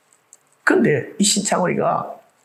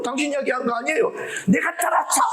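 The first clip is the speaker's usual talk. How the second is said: fast, high, hurried protest; flustered denial; 8 semitones higher than usual. Retold or imitated. Frightened bystanders explaining to Cain, fast flustered denial. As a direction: blurt it out, higher and quicker. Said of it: "그 사람들은 깜짝 놀라서".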